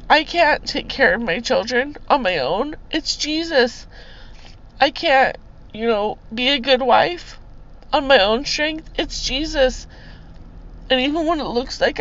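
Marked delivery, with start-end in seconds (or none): none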